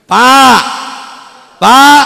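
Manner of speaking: loud, high call to the men. Real, not acted. Preacher calling out to the men, loud call. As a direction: call across the hall to the men.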